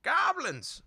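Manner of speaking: put-on high shrill-free old woman's holler, loud. A narrator's reading. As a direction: bellow it over the market. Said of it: "an old lady hollered".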